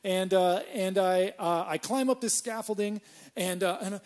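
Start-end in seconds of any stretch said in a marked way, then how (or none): none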